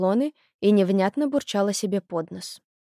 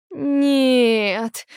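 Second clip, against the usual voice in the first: put-on drawn-out, childlike whine; whining; 3 semitones higher than usual. A narrator's reading. Ulka objects to her mother about a visitor, whining. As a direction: whine the refusal, stretching it out. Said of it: "захныкала Улька".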